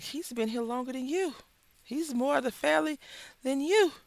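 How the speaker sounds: loud, high scolding of a roommate. Retold or imitated.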